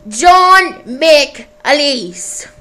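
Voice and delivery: drawn-out, loud announcer title; big loud radio announcer intro; radio show title. Acted. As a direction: announce the show name slowly and loudly. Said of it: "All the voices were by me".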